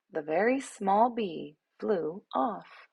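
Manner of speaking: ordinary delivery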